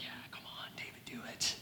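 whispered egging-on; hushed, conspiratorial urging. Acted. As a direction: whisper it like men urging their leader on. Men secretly urging David.

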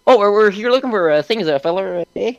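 high, hammed-up Irish-Canadian accent; terrible Canadian accent. Player imitating himself talking to the store owner, terrible Canadian accent. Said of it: "Canadian accent, in our terrible".

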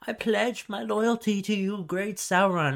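bow and scrape, hammed-up; groveling old man. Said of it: "is like".

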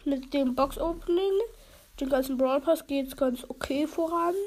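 normal talking voice

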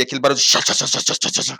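unvoiced rattling chá chá sounds; rattling noise imitation. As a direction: imitate a shaking van. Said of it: "aquele barulho de Kombi".

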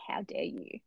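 quiet, mock-affronted gasp of words; hushed outrage. Reader telling how she scolded the author, hushed outrage. Telling her own outraged outburst while reading.

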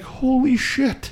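high, slow stunned exclamation; drawn-out disbelief at the cash; amazed. Retold or imitated. Surprised at earning a hundred.

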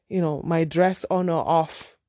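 ordinary talk, nothing marked

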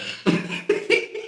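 breathy laugh. Real, not acted. Laughing at own joke.

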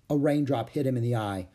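usual speaking voice

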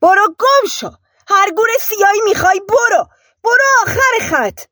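high, loud furious yell; sudden shout. A narrator's reading. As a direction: scream them out of the room. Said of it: "ناگهان فریاد زد".

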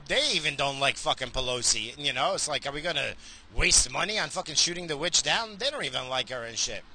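ordinary talk, nothing marked

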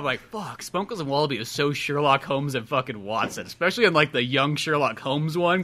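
ordinary delivery

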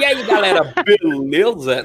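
slow drawn-out mock greeting; lightly voiced imitation of the clip; dubbing the video. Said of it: "Faz a sua dublagem aí".

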